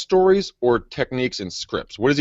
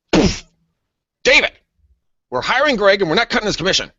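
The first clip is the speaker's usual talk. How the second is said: high, quick mock wife's voice; wife cutting off her husband, higher and quick; 12 semitones higher, 3 dB louder than usual. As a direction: imitate the wife deciding, pitched up fast. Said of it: "the wife just turns to him and goes".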